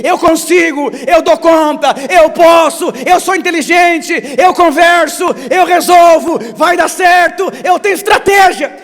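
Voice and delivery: loud, high mock bragging; boastful self-talk. Pastor mocks a self-confident believer, shouting boasts. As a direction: hammer each eu like a proud man. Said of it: "proud self-reliant believer".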